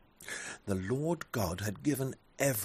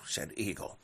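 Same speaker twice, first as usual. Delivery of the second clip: ordinary delivery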